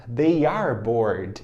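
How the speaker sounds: They are bored, slow with they and are apart; teacher modelling the long form They are; full form, slow. Shown as a demonstration. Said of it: "forma contraída".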